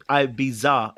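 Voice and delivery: Ibiza drawn out as "eye-bee-ZA". Shown as a demonstration. Host rejects chat's version, slow Ibiza as eye-bee-za. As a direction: stretch Ibiza slowly with a Z. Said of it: "it's never been said".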